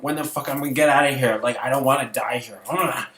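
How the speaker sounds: loud, fast, desperate; angry panic. Retold or imitated. Locked in a nailed coffin, shouting in angry panic. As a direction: yell it out fast like you're trapped. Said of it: "I was all angry".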